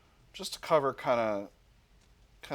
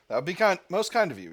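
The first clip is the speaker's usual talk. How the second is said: higher, louder courteous officer; polite British officer; 6 semitones higher, 6 dB louder, faster than usual. Acted. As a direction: thank him graciously.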